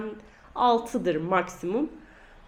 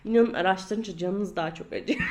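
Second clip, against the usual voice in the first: ordinary talk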